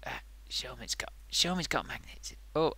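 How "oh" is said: ordinary talk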